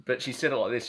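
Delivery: ordinary delivery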